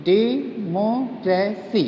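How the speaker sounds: "Democracy" slowly, डे, मो, क्रे, सी; four evenly separated beats on "democracy"; syllable by syllable. Shown as a demonstration. Teacher repeating democracy for students, slowly split in four. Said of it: "I repeat".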